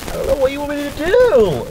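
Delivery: a touch of whiny helplessness; helpless exasperation. Retold or imitated. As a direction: throw up your hands in mock despair. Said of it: "I'm like".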